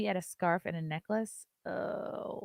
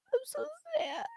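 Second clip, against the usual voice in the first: mock crying. Friend dropped out, mock crying.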